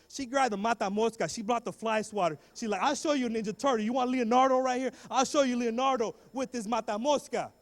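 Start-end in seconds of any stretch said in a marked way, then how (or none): 2.8-7.5 loud, raised mock scolding; threatening mom. Mom brandishing the fly swatter, loud threat. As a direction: imitate an angry mother threatening loudly.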